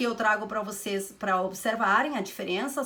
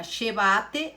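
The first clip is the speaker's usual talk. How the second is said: "llévate" with the stress moved to VA, lle-VÁ-te; stress on middle syllable; 3 dB louder than usual. Shown as a demonstration. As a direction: draw out the VA in "llévate". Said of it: "llévate é vos".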